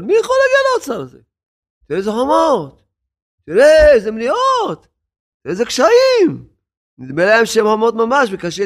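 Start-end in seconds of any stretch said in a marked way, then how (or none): 0.0-2.8 very high and fast, in despair; despairing crowd. Acted. People facing the walls, high fast despair. 3.5-6.5 higher and louder, in dismay; despairing crowd. Acted. People facing the walls, high dismayed cry.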